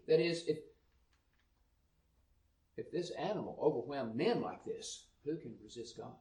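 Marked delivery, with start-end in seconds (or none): none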